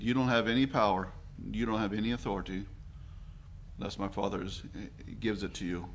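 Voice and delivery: lightly voiced calm authority; calm and quiet. Jesus retold answering Pilate, soft calm fading voice. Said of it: "calmly and quietly said".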